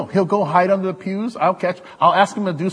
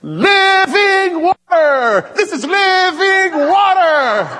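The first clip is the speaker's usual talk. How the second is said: high, slow, loud preacher shout; holy shout; 12 semitones higher, 6 dB louder, much slower than usual. Retold or imitated. Imitating Mossy shouting over a water bottle, high and slow. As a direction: belt it out like a revival prophet. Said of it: "He starts going like this".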